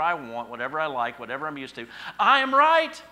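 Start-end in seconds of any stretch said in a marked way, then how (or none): 2.2-3.0 much higher, much louder "I am right"; mock proclamation. Preacher mocking the heart that always thinks it's right, in a loud mock shout. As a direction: blare it like a self-righteous decree.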